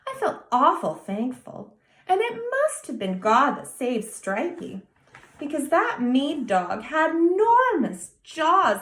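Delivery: put-on high, eager child's voice; a small boy pleading his puzzled case; earnest little boy. A narrator's reading. Young boy explains his kitten to Mrs Blythe, high and eager.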